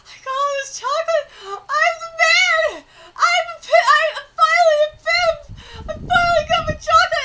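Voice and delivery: high, loud mock bragging; boasting. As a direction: crow it as the overjoyed character. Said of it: "he's like".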